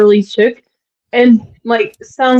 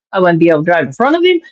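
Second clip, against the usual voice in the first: normal talking voice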